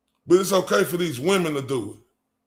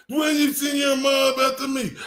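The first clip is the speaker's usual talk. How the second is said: mocking whine; 6 semitones higher than usual. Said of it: "mocking caller's complaint".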